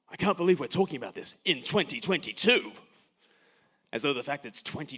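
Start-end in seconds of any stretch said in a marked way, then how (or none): 0.1-2.7 put-on exasperated voice, a little higher and louder; mocking "how can this still happen" tone; mock indignation. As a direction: parody the smug modern complaint. Mocking a common modern saying.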